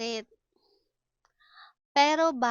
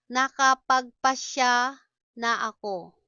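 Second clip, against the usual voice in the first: syllable by syllable, pause mid-phrase. Shown as a demonstration. Slow model, then natural speed.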